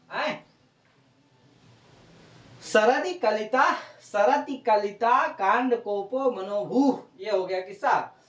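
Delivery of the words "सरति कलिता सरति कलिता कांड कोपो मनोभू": slow, emphatic Sanskrit recitation; verse recitation. Real, not acted. Teacher repeating the verse's last line for students, slow recitation. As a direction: sound out the verse line with long drawn vowels.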